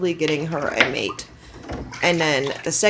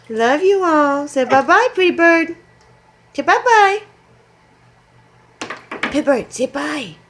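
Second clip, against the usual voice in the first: high, loud sing-song goodbye; 9 semitones higher, 4 dB louder than usual. Real, not acted. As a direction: coax the bird to wave bye. Waving bye with the bird.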